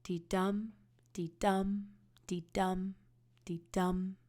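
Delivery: "Dee-dum" soft on "dee", hit on "dum", steady heartbeat; iambic rhythm. Shown as a demonstration. Teacher demonstrating the iambic beat to students, soft then strong. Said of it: "The pattern sounds like this".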